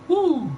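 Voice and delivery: high-pitched drawn-out "woo"; high whoop. Real, not acted. Streamer marvelling at game sale money, high whoop. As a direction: let out a high, impressed whoo.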